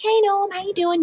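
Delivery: high, sing-out hello to a little gnome; cheery greeting. Acted. Passing the house gnome and saying hello, high playful greeting.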